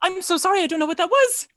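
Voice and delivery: high, flustered apology. Acted. She apologises to the party after blurting in Infernal, flustered. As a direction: catch yourself, all apologetic and rattled.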